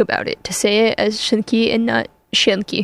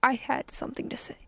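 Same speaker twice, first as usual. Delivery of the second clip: whisper; 16 dB quieter, faster than usual. Real, not acted. Whispered aside before talking.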